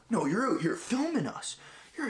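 high, pinched imitation of an accusing gym guy; mock angry accusation. Angry gym guy accusing him of filming, high mock outrage. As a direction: squeak it out like an outraged stranger.

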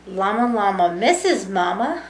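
usual speaking voice

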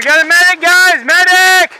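loud, high call to the team; man down shout. Real, not acted. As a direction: shout it across the field. Calling medic for hit teammate.

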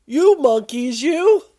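loud, high-pitched scolding; storybook peddler's cross outburst; angry peddler. Acted. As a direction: scold them loudly, punching each "you". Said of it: "made the peddler very angry".

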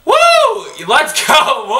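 high loud whoop; excited cheer. Real, not acted. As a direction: cheer in triumph. Celebrating the game's end.

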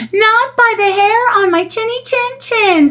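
very high squealing little pig; panicked squeal. Acted. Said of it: "squealed the panicked pig".

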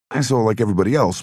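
hurried, flustered rush. A narrator's reading. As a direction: rush the words to cover the slip. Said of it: "tried to rush past the moment by speaking quickly".

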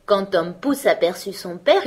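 ordinary delivery, nothing marked

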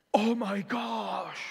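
slow, drawn-out awed exclaim; awestruck. Retold or imitated. Tourist gazing at the canyon, slow awestruck exclaim. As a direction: breathe it out in wonder.

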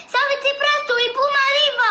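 high panicked yell; shouting. Acted. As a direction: scream the warning high. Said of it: "en hurlant".

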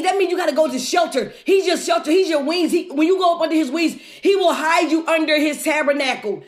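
normal talking voice

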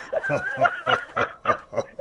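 a squealing high laugh. Real, not acted. Laughing at punchline.

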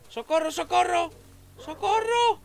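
panicked help scream. Real, not acted. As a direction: shriek for help while fleeing. Fleeing the monster in panic.

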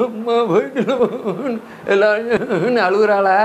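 drawn-out words broken by laughter; laughing while talking. Real, not acted. Teacher retelling the family's weeping, laughing through it. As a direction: laugh through the slow question.

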